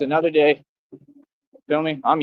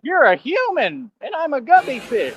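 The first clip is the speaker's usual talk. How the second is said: mock girly voice; 9 semitones higher than usual.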